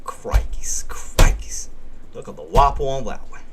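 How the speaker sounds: playful Aussie catchphrase, Steve Irwin style; mock Australian wildlife-host accent; Australian accent impression. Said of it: "you sounded like Steve Irwin".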